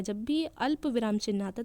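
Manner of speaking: usual speaking voice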